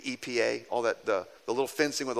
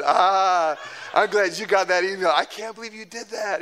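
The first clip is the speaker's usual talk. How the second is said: laughing through the words, higher and louder; amused teasing of a sheepish friend; 4 semitones higher, 4 dB louder than usual. Retold or imitated. Pastor laughing at David's mistake. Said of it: "I laughed".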